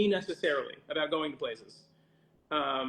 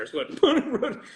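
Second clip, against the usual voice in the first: laughing; 7 semitones higher, much slower than usual. Real, not acted.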